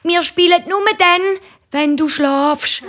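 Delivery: hohe bettelnde Kinderstimme; bettelnd. A narrator's reading. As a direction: bettle hoch, am Ende gedehnt. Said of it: "bettelt Nina und der Roger". (bättlendi Chinderstimm)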